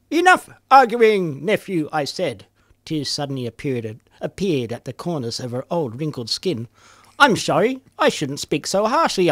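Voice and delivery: poem reading. Acted. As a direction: perform the nonsense poem. Reads absurd story poem aloud.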